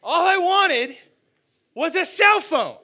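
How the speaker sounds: high, loud, drawn-out protest; outraged inner tantrum. Acted. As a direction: wail the unfair comparison, building to a shriek. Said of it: "But inside I'm thinking".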